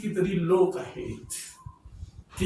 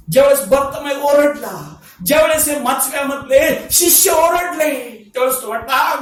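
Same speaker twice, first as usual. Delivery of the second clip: fiery preaching; 6 semitones higher, 9 dB louder than usual. Acted. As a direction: preach it out at full force to the congregation. Preaching burst. (जोरदार उंच आवाजात प्रवचन)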